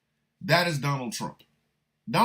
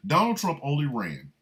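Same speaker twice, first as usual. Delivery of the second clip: ordinary talk, nothing marked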